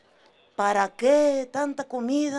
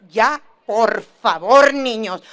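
fed-up mom; 4 semitones lower, 8 dB louder, slower than usual. Retold or imitated. Mamá harta regaña a niños.

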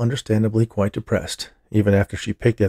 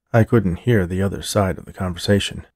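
ordinary delivery, nothing marked

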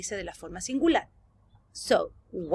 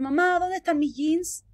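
lightly acted, higher-pitched question to mum; everyday higher-pitched call for mum; 7 semitones higher, 3 dB louder than usual. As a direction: ask mum as if calling out for lost clothes. Example of asking mum for jeans.

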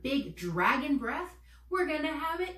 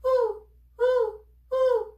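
very high, loud short hoots; short owl breaths; 12 semitones higher, 8 dB louder, much slower than usual. Shown as a demonstration. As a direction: puff out quick owl hoots. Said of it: "like this".

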